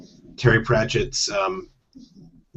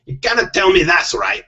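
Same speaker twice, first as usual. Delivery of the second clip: hammed-up Scottish husband, reluctant; Scottish accent; 8 semitones higher than usual. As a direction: complain in a put-on Scottish accent. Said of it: "I do have to do Scottish accents".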